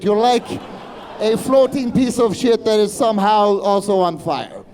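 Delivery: ordinary talk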